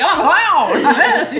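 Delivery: high, loud drawn-out goofy cry; big goofy high-pitched proclamation; mock clown announcement. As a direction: announce it like a silly clown, stretched out. Acting out a clown announcing itself.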